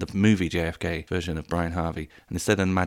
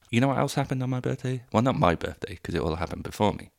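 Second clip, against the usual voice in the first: ordinary talk, nothing marked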